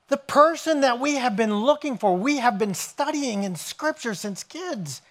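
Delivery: high, eager voice, slowing on the last words; bursting to share the big news; excited news. Retold or imitated. Philip running to tell Nathanael he found the Messiah, excited and high.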